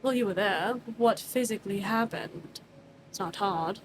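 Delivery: low, quiet, firm questioning; lightly voiced calm stern interrogator. Arminius questioning Cyrus, quietly firm. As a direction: press for answers without raising the voice.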